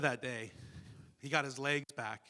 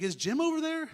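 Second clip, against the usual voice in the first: very high, loud question; retold eager question; 8 dB louder than usual. Speaker asking Dave about Jim, eager and amazed. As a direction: ask in amazement, pitch leaping up. Checking by phone in dream.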